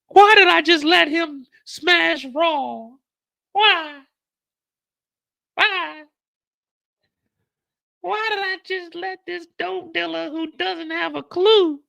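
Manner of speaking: very high, loud falsetto wail; mock crying girlfriend. Effie sobbing in the bathroom over Kane, high falsetto wail. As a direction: whine it out like a weepy girl. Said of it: "she runs to the bathroom".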